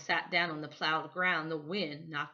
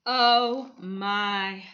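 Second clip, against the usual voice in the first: moan; 2 semitones higher, 8 dB louder than usual. A narrator's reading. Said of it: "the photographer moaned".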